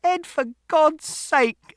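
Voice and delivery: very high, drawn-out loud cry; exasperated shout. A narrator's reading. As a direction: cry out to stop him. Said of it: "Mother shouted".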